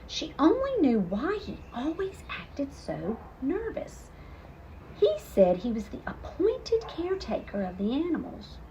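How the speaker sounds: usual speaking voice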